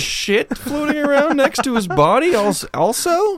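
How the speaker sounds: high, shocked mourner's cry. Pretend. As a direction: gasp at the sight. Mourner at the funeral.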